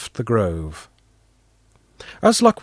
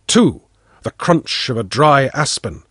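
ordinary delivery

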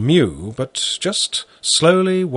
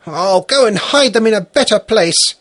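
reassuring; 11 semitones higher, 5 dB louder than usual. Acted. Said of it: "Fyodor reassured her".

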